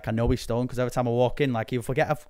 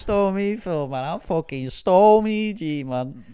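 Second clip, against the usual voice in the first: slow, louder, higher swagger; mock hard man; 6 semitones higher, 4 dB louder than usual. Joking with his mate, slow higher mock roadman boast. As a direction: drag out stormy G like a roadman.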